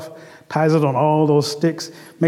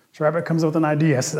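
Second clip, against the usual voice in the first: usual speaking voice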